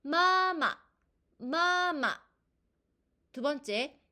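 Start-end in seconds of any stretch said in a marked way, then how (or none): 0.0-0.8 마마 with a high held first syllable, short drop on the second; high level 마, then brief falling 마; first tone plus neutral tone. Shown as a demonstration. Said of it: "일 성 뒤에 경성의 소리는요". 1.4-2.2 마마 with a high held first syllable, short drop on the second; high level 마, then brief falling 마; first tone plus neutral tone. Shown as a demonstration. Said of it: "일 성 뒤에 경성의 소리는요".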